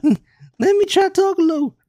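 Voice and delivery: very high put-on squeak; badly faked high voice. Trying the voice for friends, badly faked high voice. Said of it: "that was bad".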